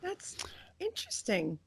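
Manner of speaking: soft whispered aside; whispering. Real, not acted. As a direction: breathe it quietly. Quiet reaction to news.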